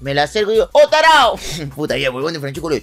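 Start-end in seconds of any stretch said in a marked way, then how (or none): 0.7-1.4 loud, high mock call-out; surprised hailing. Narrator greets Francescoli in the plaza, loud high shout. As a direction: call out sharply to catch a friend.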